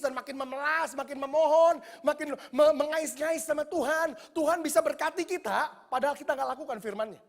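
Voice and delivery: loud, high, fervent preaching; preaching at full force. Acted. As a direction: hammer the warning to the church. (berkhotbah keras dengan nada tinggi)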